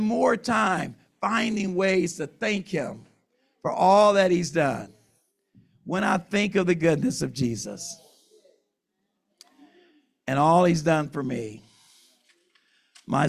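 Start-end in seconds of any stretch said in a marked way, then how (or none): none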